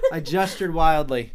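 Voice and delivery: loud, through helpless laughter; laughing. Real, not acted. Host to cohost, interrupting the story, laughing. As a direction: talk while cracking up.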